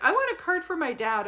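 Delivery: high, loud imitation of an excited client; eager request. Client asking for her dad's card, mock-eager. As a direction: blurt it out high and loud, mimicking.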